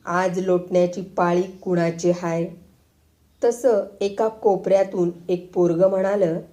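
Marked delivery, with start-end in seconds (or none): none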